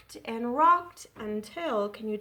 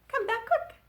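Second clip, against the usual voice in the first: much higher, louder; quick; 13 semitones higher, 5 dB louder than usual. Shown as a demonstration. Children's rhyme, quick line sung high. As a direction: fire it up high.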